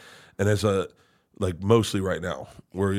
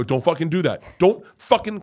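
sharp rebuke, pitched up; stern dad scolding; 7 semitones higher, 6 dB louder than usual. Retold or imitated. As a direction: shut the crying down, higher and forceful. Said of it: "So if Leanne or Georgia or Isla cry".

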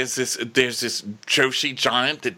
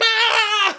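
high laughing yelp; a silly shrieked charge; mock battle cry; 5 dB louder than usual. Harwin charging Criston with a high, laughing mock yell.

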